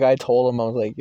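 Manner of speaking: normal talking voice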